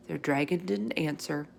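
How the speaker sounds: plain reading voice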